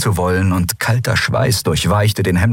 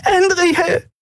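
very high-pitched, distressed cry; anguished call. A narrator's reading. Weeping friend calls to the tortured captain, anguished. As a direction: call out the name in horror. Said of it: "hörte sie Nelen rufen".

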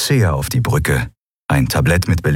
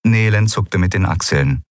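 ordinary delivery, nothing marked

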